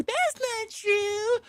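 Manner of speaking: put-on high, slow little baby voice. Cheating girlfriend denying it in a high baby voice. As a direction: plead innocent like a small child, high and drawn out. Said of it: "that little baby voice".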